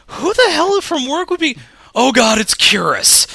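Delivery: loud, high, retold shock; exclaimed realisation. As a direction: shout it as the penny drops, re-enacted. Re-enacts his shocked realisation.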